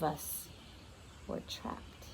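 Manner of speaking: breathy whisper to close. Acted. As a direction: whisper the ending. Whispered end of chapter.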